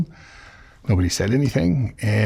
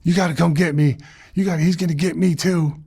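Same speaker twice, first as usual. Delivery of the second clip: high-pitched imitation of a panicked plea; mock scream for help; 7 semitones higher than usual. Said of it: "I hear the other guy screaming".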